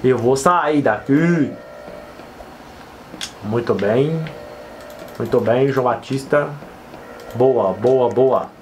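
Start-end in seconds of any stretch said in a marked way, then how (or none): 3.4-8.5 deep self-encouraging coach; low, steady pep talk to himself. Pretend. Praising character João Batista.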